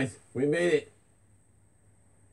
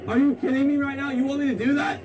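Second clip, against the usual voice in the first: high, loud, laughing disbelief; incredulous laughing; 10 semitones higher, 5 dB louder than usual. Real, not acted. Vlogger to the caller who wants him to write on himself, high laughing disbelief. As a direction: laugh off the ridiculous idea.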